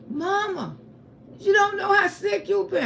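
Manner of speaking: high, urgent pleading son; earnest son. Retold or imitated. Son telling his mama how sick she was, high and urgent.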